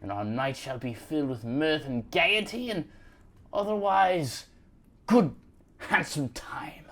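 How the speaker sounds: florid Victorian English gentleman, often high; Dickensian British. Acted. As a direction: woo a lady in grand old-fashioned British. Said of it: "like you're in a Charles Dickens book".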